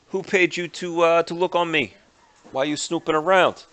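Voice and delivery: high, loud, agitated Russian thug; agitated Vinny. Acted. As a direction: snap at him, high and loud. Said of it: "Vinny in a very agitated tone".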